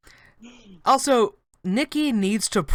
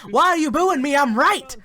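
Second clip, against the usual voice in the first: high, loud, put-on outrage; mock indignant protest; 9 semitones higher, 6 dB louder than usual. Podcaster playing wrestler booed by crowd, mock indignant. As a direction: cry out as if wronged by the crowd.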